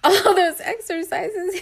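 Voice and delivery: slow, laughing through each word; barely getting it out through laughter. Real, not acted. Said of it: "getting all giggly".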